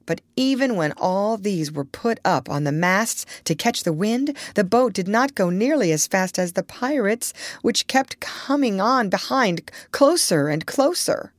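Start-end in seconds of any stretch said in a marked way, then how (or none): none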